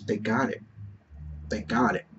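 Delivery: very soft and low, retold awe; hushed, moved. Moviegoer telling why he was crying, low and hushed. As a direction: repeat it low, barely voiced. Reliving what made him cry.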